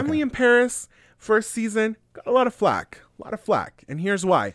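high, loud eager sales pitch; excited pitch. Real, not acted. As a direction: launch into it like a pitch.

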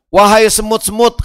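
loud high call to the ants; ant king calling out. Acted. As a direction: shout the warning to the colony.

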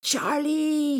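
high, loud drawn-out scream; desperate high-pitched yell; screamed call. Acted. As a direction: scream out a name to someone trapped. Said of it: "he screamed".

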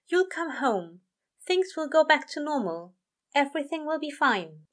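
cheerful reassurance. A narrator's reading. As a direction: angel cheering up his friend.

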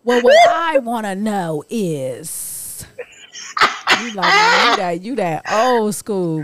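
drawn-out words, stretching each last word slow and loud; dragged-out old-school mom. Retold or imitated. Imitating her mother dragging out the last word. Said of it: "She gotta drag it out".

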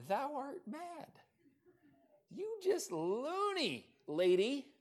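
high, drawn-out mock scoff; mocking disbelief. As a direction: stretch it slowly in high-pitched ridicule. Group mocking Rhoda as crazy.

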